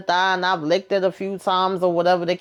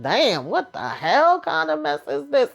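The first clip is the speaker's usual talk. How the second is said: higher voice, scandalised exclamation; shocked disbelief; 6 semitones higher than usual. Retold or imitated. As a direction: react like you can't believe it. Own retold shocked reaction.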